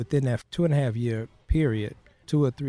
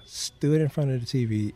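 ordinary talk, nothing marked

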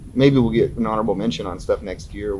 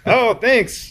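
high, louder put-on thank you; mock awkward gratitude; 13 semitones higher, 5 dB louder than usual. Musician imitates answering fans' compliments, high awkward mock thanks. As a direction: overdo an embarrassed thanks.

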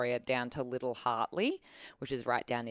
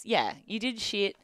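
mock scolding; 6 semitones higher than usual.

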